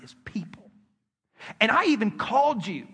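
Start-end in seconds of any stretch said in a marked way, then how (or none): 0.0-0.7 soft whisper. Acted. God's voice, gentle rebuke.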